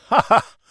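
high, loud "ha ha"; hearty acted laugh. A narrator's reading. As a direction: laugh out at the visitor's joke. Amused host laughs at the joke.

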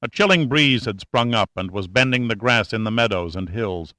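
ordinary delivery, nothing marked

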